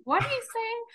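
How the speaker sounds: high, incredulous mock question; baffled listeners. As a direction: imitate puzzled listeners, pitch leaping high on the question. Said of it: "and people still say".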